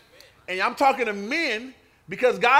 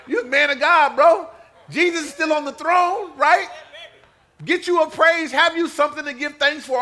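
mock hype encouragement; 5 semitones higher, 7 dB louder than usual. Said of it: "It's just like".

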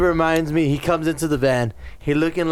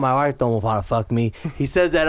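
normal talking voice

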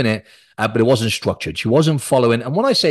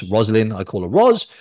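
normal talking voice

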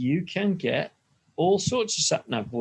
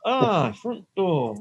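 higher, slow Yorkshire; Yorkshire accent; 5 semitones higher, much slower than usual. Acted. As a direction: drawl it out in mock Yorkshire. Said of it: "in a Yorkshire accent".

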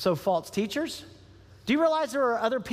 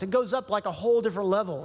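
ordinary delivery

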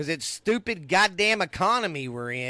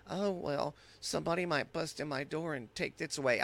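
mock worried murmur; 10 dB quieter than usual. Mocking his own fearful thoughts.

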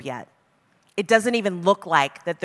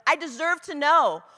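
past self demanding why; 7 semitones higher, 5 dB louder than usual. Retold or imitated. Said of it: "I've done it where I've like".

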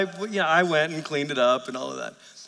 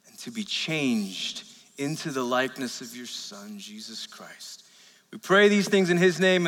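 opening prayer; 3 semitones lower than usual. Acted. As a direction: pray gently over the congregation. Pastor leads opening prayer.

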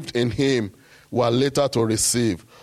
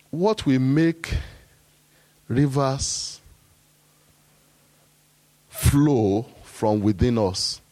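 slow dramatic question; much slower than usual. Acted. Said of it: "rhetorical question drawn out".

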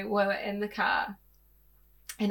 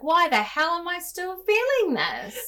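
higher, louder frustrated self-questioning; exasperated self-talk; 9 semitones higher, 10 dB louder than usual. Retold or imitated. Frustrated with her own healing, higher and louder. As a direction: vent at yourself, slowing on the end. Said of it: "I'm like".

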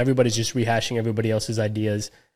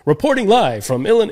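high, bright TV news anchor; reporter voice; 9 semitones higher than usual. Retold or imitated. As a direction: announce it like a local TV reporter. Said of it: "she has that reporter voice".

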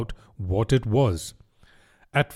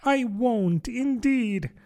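high, placating Alice voice; pitched-up, eager-to-please girl; hurried assurance; 10 semitones higher, slower than usual. A narrator's reading. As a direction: promise anxiously to drop the matter. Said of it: "said Alice in a great hurry".

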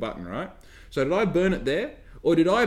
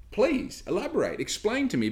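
pitched higher, mock-polite challenge; sarcastic demand; 8 semitones higher than usual. Real, not acted. Ranting at a customer, sarcastically polite demand. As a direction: dare them to explain.

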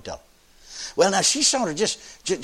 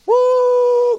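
high loud whoop; joyful shout; 8 dB louder than usual. Real, not acted. Preacher whooping with the congregation, loud. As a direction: let out a church cry of joy.

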